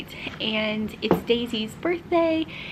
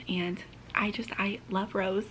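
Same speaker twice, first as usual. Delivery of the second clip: whisper; 3 semitones lower, 7 dB quieter than usual. Real, not acted. Quiet aside about her sister.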